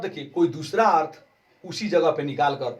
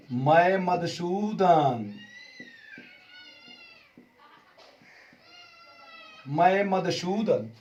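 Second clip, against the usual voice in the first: drawn-out announcement; 4 semitones higher, much slower than usual. Acted. Krishna announcing himself at door.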